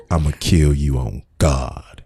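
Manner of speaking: slow, low chilling threat with a laugh underneath; mock menace, slow and low; imitating a menacing tone. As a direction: mimic the menace slowly. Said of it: "with that voice at that tone".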